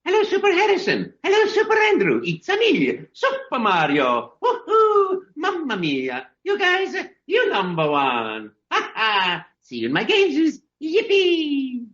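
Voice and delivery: very high, exuberant cartoon Italian voice with whoops; Mario. Voice actor giving host's relatives a shout-out, exuberant Mario. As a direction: greet fans in high, bouncy bursts, swooping down on phrase endings. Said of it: "that you are Mario".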